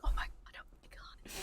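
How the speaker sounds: barely audible voiceless whisper; imitated audience whispering. Imitating moviegoers around her during cool moments, hushed whisper. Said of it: "Like, whispering".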